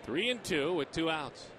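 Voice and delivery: loud, high live radio call; excited play-by-play. Real, not acted. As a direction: sell the play, then tease the break.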